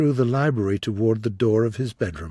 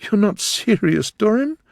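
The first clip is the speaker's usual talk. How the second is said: murmured plea; 11 semitones higher, 4 dB louder than usual. Acted. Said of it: "she murmured".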